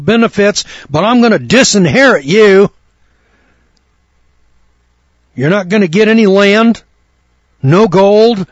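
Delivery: high, loud, booming pulpit proclamation; fiery preacher voicing God; preaching at full force. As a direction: thunder it out as God's own decree.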